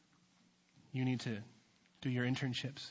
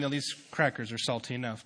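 ordinary talk, nothing marked

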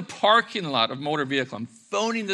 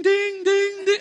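high, louder mimicked notification dings; ping it like a phone going off; rising ding ding of a phone; phone ding noises; 6 dB louder, slower than usual. Phone pinging with messages.

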